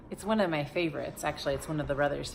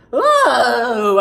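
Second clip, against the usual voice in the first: loud, high shocked "Oh"; shocked cry before fainting; startled gasp; 7 semitones higher, 17 dB louder than usual. Acted. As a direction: jump at the sight of a lion. Schoolmaster sees the lion.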